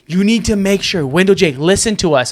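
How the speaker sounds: imitated insistent instruction. Imitating mom and Gabby instructing the young host, insistent. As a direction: press the point like a worried organiser.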